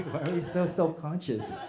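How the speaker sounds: ordinary talk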